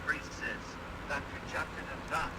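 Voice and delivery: garbled, mumbled run of words; mumbling. Real, not acted. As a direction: mumble it like nonsense rap. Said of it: "You, you just started mumbling".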